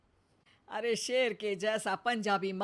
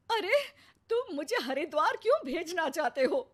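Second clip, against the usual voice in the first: indignant outrage; 7 semitones higher than usual. Acted. Wife indignant at husband's joke.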